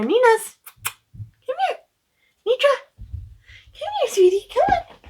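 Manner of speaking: high, sweet pet talk to the dog; high cooing call to a sweetheart pup. Real, not acted. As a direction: coax the dog over in a sing-song. Calling her service dog over.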